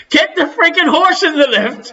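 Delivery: high-pitched mimicked Dublin accent, laughing; comic Dublin accent breaking into laughter; mock Dublin accent. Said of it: "let Pete hear your Dublin accent".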